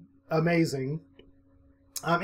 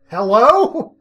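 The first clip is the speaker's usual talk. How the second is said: loud, higher "hello" into the unknown; nervous calling out; 6 semitones higher, 9 dB louder than usual. Acted. Calling down a dark hallway after hearing a bump, acted loud call. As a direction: call out as if to someone down the hall. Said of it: "I go, just like this".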